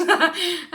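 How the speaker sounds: short high laugh at one's own joke. Real, not acted. Reader joking about angering the spirits, laughing. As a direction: let out a high, amused little laugh.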